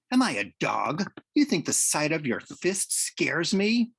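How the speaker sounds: scornful, heated comeback; indignant challenge. Acted. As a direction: throw it back at him, voice climbing. Acted quarrel between catcher and pitcher.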